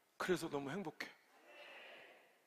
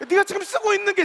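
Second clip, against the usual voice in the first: much higher and louder, pointing at 네가; exasperated father's retort; 11 semitones higher, 11 dB louder than usual. Retold or imitated. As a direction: jab the word 네가 high and loud. Father scolding child about dialect.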